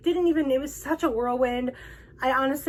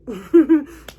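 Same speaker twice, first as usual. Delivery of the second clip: a sudden, loud, real laugh; burst of laughter; 3 semitones higher, 11 dB louder than usual. Real, not acted. Fan recalling hugging her hero, a loud laugh. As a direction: let the laugh burst out of you, much louder.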